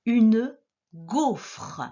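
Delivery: UNE GAUFRE slow, each syllable separated; word dictation. Shown as a demonstration. Teacher dictating the first word, slow syllable-by-syllable. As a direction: break UNE GAUFRE into slow pieces, final E sounded. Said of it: "Le premier mot".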